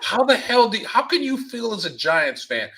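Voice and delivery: high, loud, incredulous complaint; exasperated rant. Real, not acted. Fed-up Giants fan complaining about parking prices, exasperated. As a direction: voice shoots up in real exasperation.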